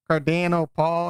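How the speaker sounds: a light put-on Irish lilt on the name; Irish accent. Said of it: "he's got that Irish".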